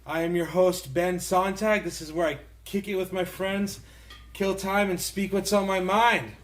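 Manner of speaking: loud, upbeat show opener; podcast host intro. Acted. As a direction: pump up the listeners like a host.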